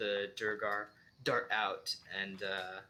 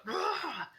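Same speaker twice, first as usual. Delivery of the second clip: high acted cry of pain; pained yell. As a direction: yell out as if slashed by an axe. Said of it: "and he yells out".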